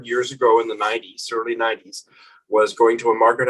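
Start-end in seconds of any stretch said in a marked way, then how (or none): none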